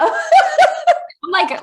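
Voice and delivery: high, loud burst of laughter through the words; laughing. Real, not acted. Host moved by guest's praise, laughing. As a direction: laugh it out, overwhelmed. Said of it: "I'm, like, crying".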